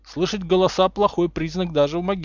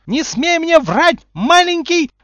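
slow, very high, loud shriek; 10 dB louder, slower than usual. A narrator's reading. Umbridge forbidding Harry to lie, shrieking. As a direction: shriek it, drawing out each word. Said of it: "взвизгнула Амбридж".

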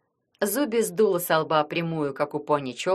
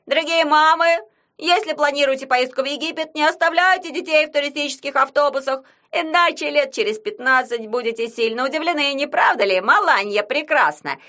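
sports commentator; 7 semitones higher, 5 dB louder than usual. Acted. Yagun commenting the match.

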